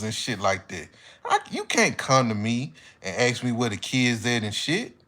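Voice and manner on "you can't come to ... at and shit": usual speaking voice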